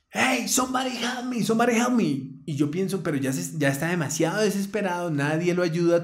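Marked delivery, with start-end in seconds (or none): 0.1-2.4 high pleading cry in English; desperate plea. Retold or imitated. Friend crying out to the frozen crowd, high pleading. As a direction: plead for help in a raised voice. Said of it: "ese man está".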